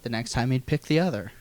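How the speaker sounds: ordinary talk, nothing marked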